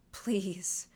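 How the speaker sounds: unvoiced, breathy begging whisper; whispered plea. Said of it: "in an agonized way".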